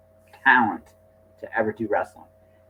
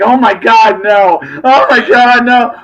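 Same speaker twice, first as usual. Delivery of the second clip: mock dread; 8 semitones higher, 7 dB louder, slower than usual. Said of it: "he was like".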